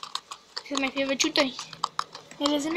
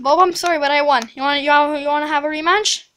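normal talking voice

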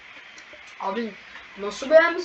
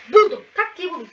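high loud burst of discovery; excited eureka; 6 semitones higher, 8 dB louder than usual. Real, not acted. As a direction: shout out your find.

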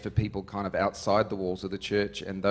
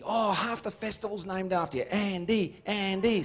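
high put-on teasing call; teasing heckle; 9 semitones higher than usual. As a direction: pick on him from the stage, pitched up. Picking on Andy from stage.

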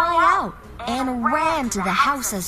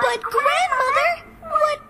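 put-on astonished girl. A narrator's reading. Girl marvels at wolf.

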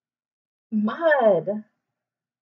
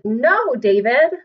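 scolding mom; 8 dB louder, slower than usual. Acted. Teacher reading a mom scolding her son. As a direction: scold him like his mom. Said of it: "David's mom always said".

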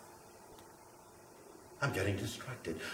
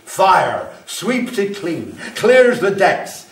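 slow, loud and higher, savouring each word; booming declaration; 6 semitones higher, 9 dB louder, much slower than usual. Acted. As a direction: proclaim it like a fire sermon. Fire-obsessed character's rising rant.

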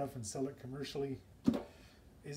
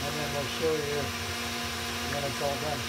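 raised voice over a running mixer; louder, carrying demonstration voice; talking louder over machine; 5 dB louder than usual. Real, not acted. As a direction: project louder to be heard over the motor. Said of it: "I'll try to talk a little bit louder".

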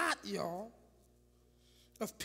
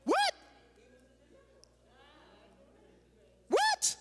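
rising, ever higher disbelieving what; shocked what; 10 semitones higher, much slower than usual. Acted. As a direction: act out stunned disbelief, each one higher. Preacher acting shocked disbelief.

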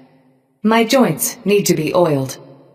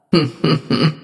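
closed-mouth villain chuckle; a brief, contained "hm-hm-hm" laugh; short chuckle. Acted. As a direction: chuckle to yourself. Game character's recorded reaction sounds.

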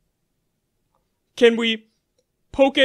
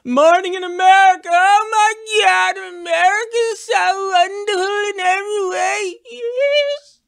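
much higher, gushing, overjoyed; gushing mock patriotism; 7 semitones higher than usual. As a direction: gush about America.

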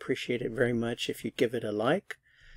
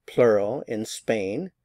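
usual speaking voice

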